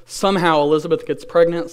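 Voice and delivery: usual speaking voice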